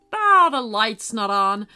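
a cheeky skeleton teasing gently; jolly skeleton. Acted. Narrator voices the skeleton.

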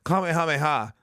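Kamehameha said with every "ha" clear, KAH-may-HAH-may-HAH; Kamehameha, carefully sounded out; correct pronunciation. Shown as a demonstration. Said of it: "that's how you fucking say it".

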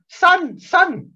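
high loud call of a bingo granny. Retold or imitated. Bingo granny calling out across the cinema, high and loud.

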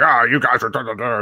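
a hint of a whiny fan; mocking defenders. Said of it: "WWE defenders going".

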